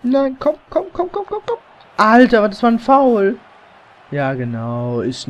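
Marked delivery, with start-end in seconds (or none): 0.0-3.4 rising frantic shout. Real, not acted. Gamer urging player, then foul.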